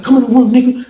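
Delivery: high-pitched imitation of a challenger; retold challenge. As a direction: call him out, acted in retelling. Acted challenge to fight.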